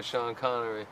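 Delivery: a hint of Scottish Connery voice; teasing, a light Scottish Connery; Sean Connery impression. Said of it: "My Jerry Butler".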